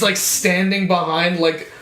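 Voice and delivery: ordinary talk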